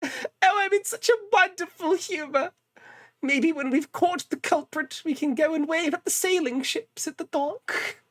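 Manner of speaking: high, prissy jolly gentleman voice; foppish Edgeworth impression. Streamer imitating Edgeworth's jolly foppish voice. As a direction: play a delighted dandy in a cravat. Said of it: "this is how he fucking sounds".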